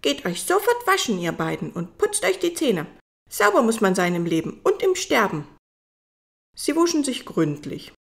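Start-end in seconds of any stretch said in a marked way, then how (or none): 0.0-5.5 bossy Malvina. Acted. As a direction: order them off to wash. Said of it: "fügte sie laut hinzu".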